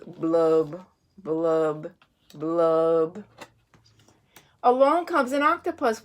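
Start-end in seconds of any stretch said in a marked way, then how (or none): 0.1-3.2 low, slow glum fish blub. Acted. Reading to a child, the sulky fish goes low on blub. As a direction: drop the voice low and let each blub sink.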